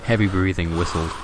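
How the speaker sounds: usual speaking voice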